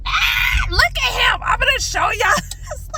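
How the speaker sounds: real high-pitched fright with a laugh; freaked out. Real, not acted. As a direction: point out the creature in a panic. Showing the lizard in panic.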